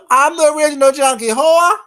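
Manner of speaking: loud boast. Real, not acted. Boasting to chatter.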